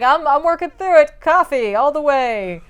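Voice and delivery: high, wired, overcaffeinated reassurance; jittery. Retold or imitated. Woman insisting coffee will get her through, jittery. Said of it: "She's just jittery and shaky".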